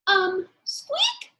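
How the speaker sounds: high, timid squeak; mouse squeak. Acted. Timid mouse squeaks at the lion.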